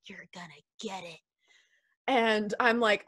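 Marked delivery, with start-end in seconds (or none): 0.1-1.2 much softer, whispered sibling teasing; hushed taunt. Retold or imitated. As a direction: whisper it so dad won't hear. Sister teasing in silent car.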